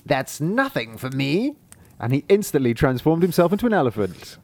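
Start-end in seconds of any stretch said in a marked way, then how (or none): 0.0-1.5 proud sorcerer. Acted. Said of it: "The sorcerer said proudly".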